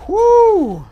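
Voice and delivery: high, loud whoop; excited cheer. Real, not acted. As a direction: whoop in triumph. Clears the tricky jump.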